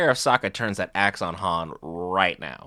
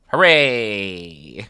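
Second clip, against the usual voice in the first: sarcastic cheer; 7 dB louder than usual. Pretend. Sarcastic cheer at bad news.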